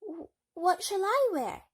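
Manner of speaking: put-on high, loud-ish, hesitant girl; timid Cinderella. A narrator's reading. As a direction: ask shyly, stumbling on the first word. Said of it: "she asked timidly".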